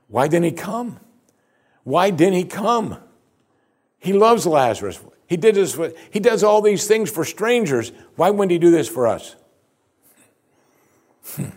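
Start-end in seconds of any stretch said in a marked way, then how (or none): 0.1-9.3 high, louder, pleading complaint; the sisters' hurt complaint, voiced high; voicing the grieving sisters.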